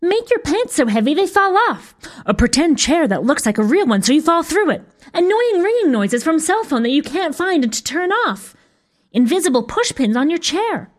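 put-on high-pitched, eager children's voices; clamouring kids. A narrator's reading. Children shout out their spell lists, clamouring. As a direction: call out each idea in a high kid voice. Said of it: "A cacophony of voices rose up".

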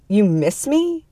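incredulous, drawn-out question; mock disbelief. A narrator's reading. Ex-husband answering "I just really miss you", mock disbelief. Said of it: "He laughed shortly".

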